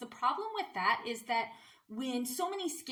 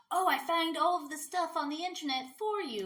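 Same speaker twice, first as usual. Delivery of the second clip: higher, put-on voice mocking a content poster; mock self-promoting account; 6 semitones higher than usual. Lazy repost account marketing itself to followers, mocking higher voice. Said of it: "try and market themselves".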